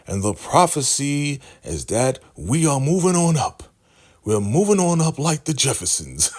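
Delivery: high, ringing mock announcement; imitated prophet proclamation. The Highest telling his people the prophecy, proclaiming. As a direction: proclaim it to a congregation. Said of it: "he's telling them".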